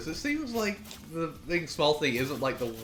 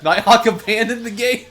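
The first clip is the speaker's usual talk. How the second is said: laughing; 8 semitones higher, 11 dB louder than usual. Real, not acted. Laughing at opponent leaving.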